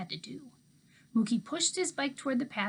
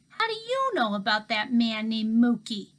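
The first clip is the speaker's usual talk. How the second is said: Toby's voice; 7 dB louder than usual. A narrator's reading. Boy Toby voiced in story.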